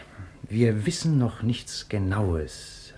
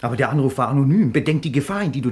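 urgent warning; 7 semitones higher, 7 dB louder than usual. Acted. Bob warns Margret of danger.

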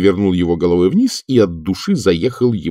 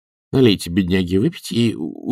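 plain reading voice

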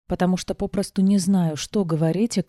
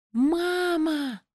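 put-on high excited child's squeal of Мама; a little girl squeals with joy; child shrieking happily for mother; 11 semitones higher than usual. A narrator's reading. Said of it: "взвизгнула Эмми".

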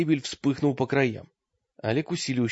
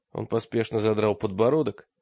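plain reading voice

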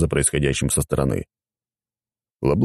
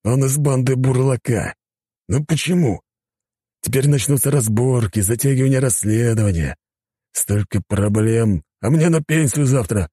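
higher-pitched, lamenting policeman; despairing inspector; 8 semitones higher than usual. Acted. Inspector facing endless investigation before retirement, despairing lament.

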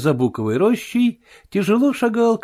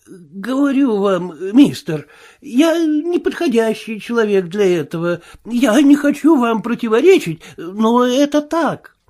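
frightened tramp; 9 semitones higher, 4 dB louder than usual. Acted. Marvel excusing himself to the invisible man, higher whine. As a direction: beg like a bruised coward.

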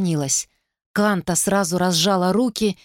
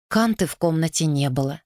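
usual speaking voice

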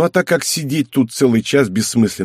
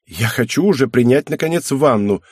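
ordinary delivery, nothing marked

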